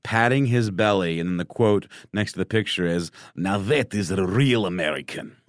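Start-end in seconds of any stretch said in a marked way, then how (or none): 3.4-5.4 put-on light Russian accent, jovial; a hint of a Russian accent. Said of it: "can I do the Russian accent".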